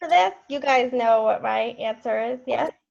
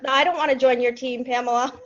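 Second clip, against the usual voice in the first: normal talking voice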